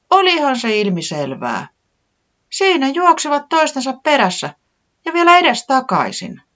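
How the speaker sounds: high, eager small chick; little Tiputi voice. A narrator's reading. Tiputi recounting what she saw, high and eager.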